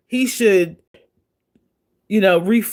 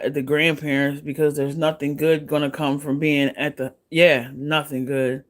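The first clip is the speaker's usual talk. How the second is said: usual speaking voice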